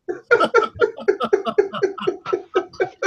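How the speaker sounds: long high-pitched laugh; big high laugh. Real, not acted. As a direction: burst out laughing. Laughing at the read post.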